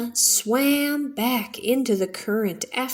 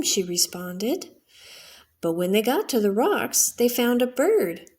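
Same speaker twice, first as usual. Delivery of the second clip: ordinary delivery, nothing marked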